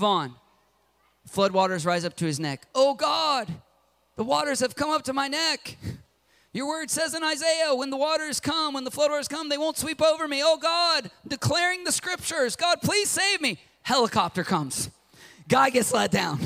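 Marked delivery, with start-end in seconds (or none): 2.7-13.6 high, pleading scripture prayer; cling to the verse in panic; desperate praying man. Retold or imitated. Drowning man quotes Isaiah to God, high desperate plea.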